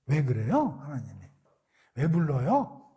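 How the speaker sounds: normal talking voice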